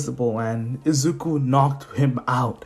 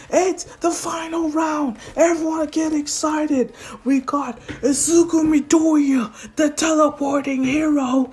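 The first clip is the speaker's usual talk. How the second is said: mock arena announcer, pitched way up; hype announcer; 14 semitones higher than usual. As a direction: introduce the fighters in a high strained cry. Tournament announcer introduces the final round.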